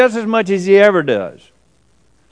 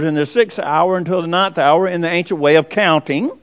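ordinary talk

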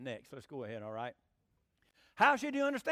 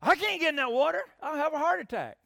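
high, loud panicked protest; a grandmother's loud frightened refusal; retold holler; 10 semitones higher, 10 dB louder than usual. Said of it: "Boy, she hollered".

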